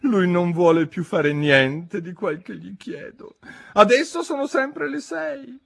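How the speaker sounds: theatrical high-pitched moan; plaintive. A narrator's reading. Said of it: "con voce lamentosa".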